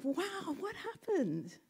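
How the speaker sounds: a light astonished gasp of a question; surprised frog. Retold or imitated. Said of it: "is like".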